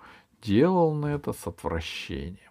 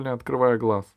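usual speaking voice

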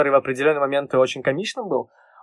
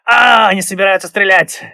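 higher, louder cry of alarm; alarmed exclamation; 6 semitones higher, 8 dB louder than usual. Retold or imitated. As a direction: shout it like a sudden realisation. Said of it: "цитирует капитана Смолета".

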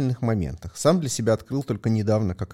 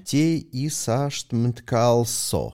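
slow spelled-out reading; 5 semitones higher, much slower than usual. Real, not acted. Struggles to read unpronounceable nickname.

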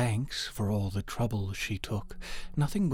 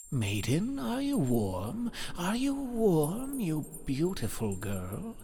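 frost king; 7 semitones higher than usual. A narrator's reading. King Frost bending over the freezing girl, insistently questioning. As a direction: swoop up high and linger on the question.

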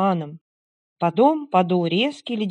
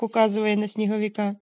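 ordinary delivery

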